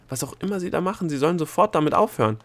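fast, high, agitated outburst; urgent demand. A narrator's reading. Delia demands the dwarfs stop at once, agitated. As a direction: rush it out, insisting.

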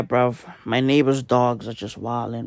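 ordinary talk

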